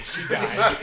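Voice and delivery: she died, laughed out breathless; can't get the words out for laughing; dissolving into laughter mid-story. Real, not acted. Laughing through retold line.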